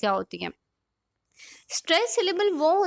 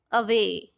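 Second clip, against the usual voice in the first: अवे with the weight on वे; stress on second syllable; 4 semitones lower, much slower than usual. Shown as a demonstration. Teacher giving students an example foot, stress on वे in अवे. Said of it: "stress किस पे आया? वे पे".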